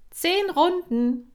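high, loud, slow coach command; commanding call. Acted. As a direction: bark out the count like a trainer. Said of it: "sagte Kira". (laut und hoch gerufenes Kommando)

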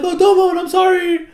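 high, loud whiny apology; hammed-up pleading cry; mock wailing kids. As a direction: wail sorry like distraught children. Kids cry out while pilot is beaten.

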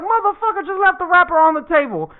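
high-pitched, acted complaint; mock indignation. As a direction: grumble upward in comic outrage. About to yell at brother.

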